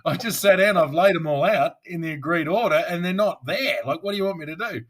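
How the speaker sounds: flustered shout of helpless frustration; frantic rant. Retold or imitated. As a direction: rant in a panic, nearly shouting. Panicked prosecutor's inner rant.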